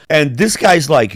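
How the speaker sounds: usual speaking voice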